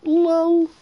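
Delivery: high, held-out sing-song "lol"; mocking lol. Other players laughing at the angry guy, high mocking lol. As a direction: taunt in a drawn-out high tone. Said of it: "Everybody else ... just laughing at him".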